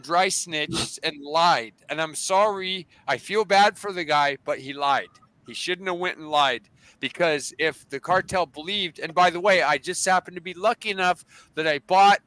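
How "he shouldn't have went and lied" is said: normal talking voice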